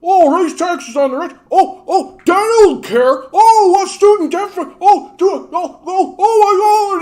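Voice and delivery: piercing falsetto, mounting excitement; Bubbles. Acted. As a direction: squeal loudly as an overexcited oddball reading a pamphlet. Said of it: "And he goes".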